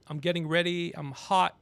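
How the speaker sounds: ordinary talk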